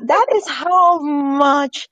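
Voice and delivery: slow, laughing through it. Real, not acted. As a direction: laugh as you start the line. Laughing at shared joke.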